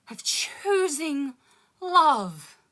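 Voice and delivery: rhythmic poetry recital with dark punchlines; spoken word poem. Acted. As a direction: perform it like a slam poem. Continues the poem.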